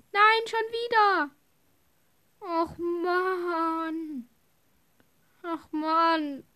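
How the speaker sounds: loud, high groan of frustration; frustrated shout. Real, not acted. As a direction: shout out in annoyance. Ceiling collapses again.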